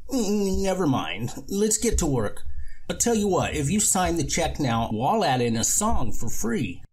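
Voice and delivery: change the subject brightly; high cheerful hustler; high eager con man. Acted. Lorne brushes it off eagerly.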